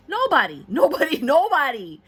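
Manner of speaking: loud, high repeated exclamation; emphatic outburst. Real, not acted. Reader answering who wants heartbreak, emphatic shout. Answers own sarcastic question, calling out.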